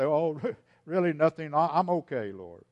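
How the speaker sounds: laughing it off with a forced chuckle; mock brave front. Worshipper fakes being fine before the Lord, forced laugh. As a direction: pretend nothing is wrong, half-laughing. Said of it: "Don't just sob and say".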